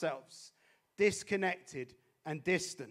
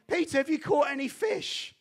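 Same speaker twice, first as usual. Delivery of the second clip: stranger's voice; 9 semitones higher, 4 dB louder than usual. Retold or imitated. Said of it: "and says to him".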